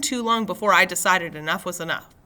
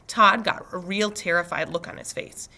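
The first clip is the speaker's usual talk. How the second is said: plain reading voice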